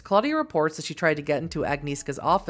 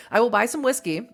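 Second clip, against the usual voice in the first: usual speaking voice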